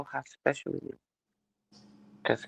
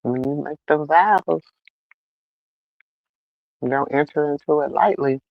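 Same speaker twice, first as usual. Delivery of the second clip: preacher quoting vows; 6 dB louder than usual. Retold or imitated. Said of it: "then the pastor told y'all".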